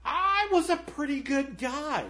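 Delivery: high, put-on voice of an outraged man; indignant ghost. Ghost scoffing at a murderer in heaven, high and indignant. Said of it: "he was like".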